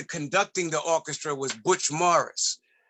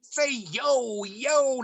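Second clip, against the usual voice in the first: mock him trying to sound street, pitched up; mock producer slang; 9 semitones higher, much slower than usual.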